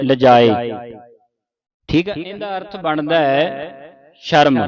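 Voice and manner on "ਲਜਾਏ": ਲਜਾਏ with a single light j; loose mistaken reading. Retold or imitated. Teacher quoting congregations' misreading, ਲਜਾਏ with single j. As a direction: say ਲਜਾਏ loosely, without doubling. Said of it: "ਪੋਲਾ ਵਿੱਚ ਪੜ੍ਹਦੀਆਂ".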